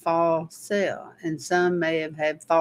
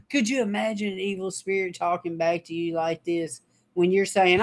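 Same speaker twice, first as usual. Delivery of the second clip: usual speaking voice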